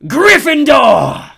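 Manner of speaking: very high, slow, loud announcing shout; booming hat proclaims "Gryffindor". A narrator's reading. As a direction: proclaim it to the whole hall. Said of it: "hat shout the last word".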